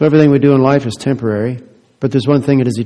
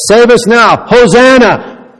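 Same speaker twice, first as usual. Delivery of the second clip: crowd acclaim; 9 semitones higher, 6 dB louder, slower than usual. Retold or imitated. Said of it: "they said".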